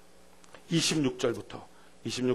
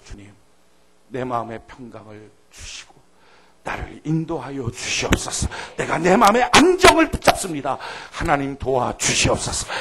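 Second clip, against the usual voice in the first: slow, earnest prayer rising to a plea; 8 dB louder than usual. Acted. Preacher modelling a prayer for the congregation, slow and earnest. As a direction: pray it, building to a firm plea.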